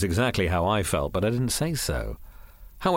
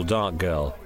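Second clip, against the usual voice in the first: plain reading voice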